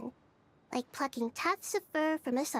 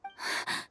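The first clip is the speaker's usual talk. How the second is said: quick startled intake of breath; a small shocked gasp; sharp gasp. Acted. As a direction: gasp in shock at what you see. The Gnosis suddenly vanishes.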